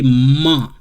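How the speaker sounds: a slow, drawn-out ma; French ma. Shown as a demonstration. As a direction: demonstrate the French ma. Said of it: "In French, we say".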